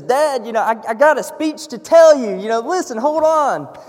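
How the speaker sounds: excited son interrupting the hug; eager son. Acted. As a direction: blurt it out, eager to explain. Son pleads inside bear hug.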